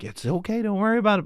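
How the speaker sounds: imitating a parent's tender comforting; consoling parent. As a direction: gently reassure an upset child. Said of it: "I'll try to console her".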